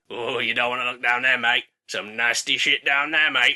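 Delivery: low, geezer-ish crow voice; a shifty car-park hustler crow; dodgy crow. Acted. Another crow.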